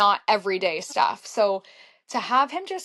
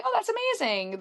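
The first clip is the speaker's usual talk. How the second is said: impressed gush; 13 semitones higher than usual. Retold or imitated. Fan reacting to a singer's race goal, impressed. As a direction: gush lightly, right up high.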